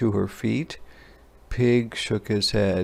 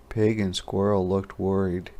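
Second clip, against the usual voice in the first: plain reading voice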